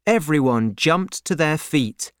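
plain reading voice

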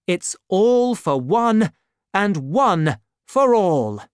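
slow, weighty pledge; solemn motto. A narrator's reading. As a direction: lay down the motto slowly for your friends. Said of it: "repeated D'Artagnan's words".

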